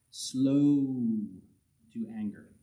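preacher savoring slow to anger; drawn-out slow. Shown as a demonstration. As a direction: linger on slow for emphasis. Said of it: "Really slow".

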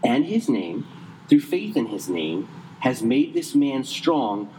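slow, deliberate reading; slow down and let each word land; careful slowed scripture reading. Shown as a demonstration. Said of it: "Chris, slow down".

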